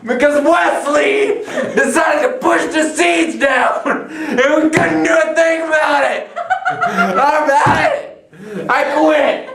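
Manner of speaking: shouting through laughter; laughing shout. Pretend. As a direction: yell it while cracking up. Laughing rant about failed experiment.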